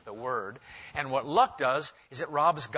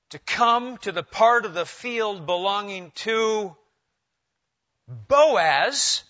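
slowly, building louder toward Boaz; slow dramatic reading; 3 semitones higher, 6 dB louder, slower than usual. Shown as a demonstration. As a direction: read it slow and let it land on Boaz. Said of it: "I'm gonna read it slowly".

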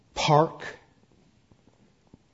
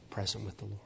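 ordinary talk, nothing marked

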